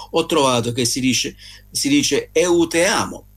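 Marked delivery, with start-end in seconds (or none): 2.3-3.3 "eu te amo" said the Portuguese way, pitched up; Portuguese "eu te amo", a little higher; Portuguese pronunciation. Shown as a demonstration. Showing the South American phrase, Italian-accented Portuguese. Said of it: "sempre se la pronuncia è corretta".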